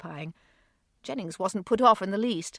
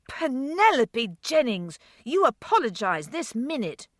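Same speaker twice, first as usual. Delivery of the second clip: shocked reprimand, louder; horrified scolding; 5 semitones higher, 4 dB louder than usual. A narrator's reading. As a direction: brother scolding little sister in horror. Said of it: "his voice telling of his horror".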